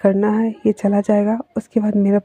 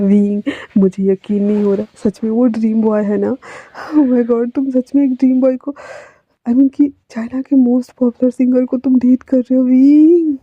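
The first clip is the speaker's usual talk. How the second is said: excited gushing; 4 semitones higher than usual. Acted. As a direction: gush like a starstruck friend. Friend gushing over dream boy.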